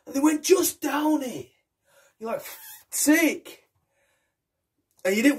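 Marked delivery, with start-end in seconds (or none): 0.4-1.5 pushy mate. Acted. Mates urging him to drink. 2.4-3.6 reluctant sigh. Acted. Reluctant boy giving in.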